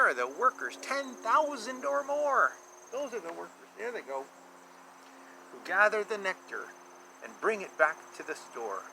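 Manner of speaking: lilting rhymed picture-book read; sing-song storybook reading for a child; reading to children. A narrator's reading. Reads rhyming picture book aloud.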